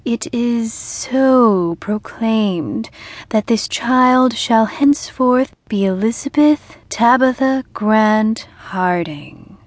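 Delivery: slow, solemn ritual pronouncement; slow formal decree; solemn proclamation. A narrator's reading. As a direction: proclaim it like a rite. Ceremonial proclamation.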